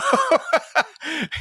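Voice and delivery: cracking up at the imagined producers; high cackle. Real, not acted. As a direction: burst into high laughter. Laughing at own bit.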